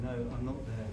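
soft, hushed reply; still quiet voice. Retold or imitated. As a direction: answer gently, barely above a breath. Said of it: "a small, still voice".

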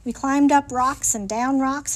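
plain reading voice

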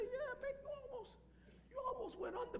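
put-on a touch of a sweet nurse calling him baby; voicing the nurse. Pastor voicing the nurse's reply, lightly voiced nurse. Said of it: "Then she said".

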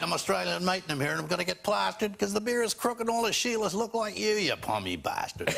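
Australian pub song. Retold or imitated. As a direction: belt it like a proud Aussie drinker. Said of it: "he used to sing things like".

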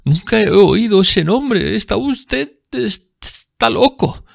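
high, slow, frightened voice; high stuttering panic; terrified denial. A narrator's reading. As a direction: plead you know nothing.

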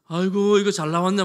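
loud, hammed-up delight of a mother at her newborn; mock proud exclaim. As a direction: boom it out like a pleased mother. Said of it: "막 이러-, 이럽니까?".

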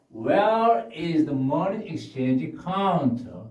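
very slow, clear; slow model question. Shown as a demonstration. Teacher modelling a question slowly for the class. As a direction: ask it word by word. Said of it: "teacher models question".

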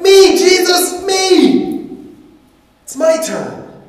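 high, loud begging shout; crowd clamoring. Acted. Crowd pushes to Jesus, shouting for a turn. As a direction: shove forward, shouting to be picked.

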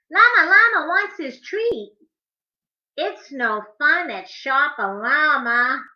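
usual speaking voice

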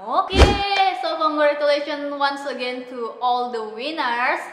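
loud, slow congratulations; hyped giveaway announcement. Acted. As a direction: celebrate all the winners. Wrapping up the giveaway.

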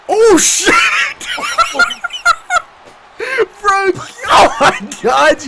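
loud high laughing outburst; shocked laughing shout. Real, not acted. Shocked by game.

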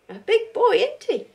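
put-on loud, high baby talk; cooing to the toy. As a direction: coo at it like a baby. Cooing over the crochet toy.

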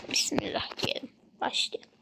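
soft whispered start; whispering. Real, not acted. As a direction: whisper it under the breath. Starting the game, hushed.

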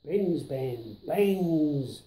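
usual speaking voice

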